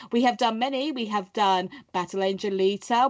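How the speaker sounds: ordinary delivery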